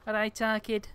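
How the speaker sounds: put-on Manc greeting; Manchester accent. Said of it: "isn't in the doing the accents".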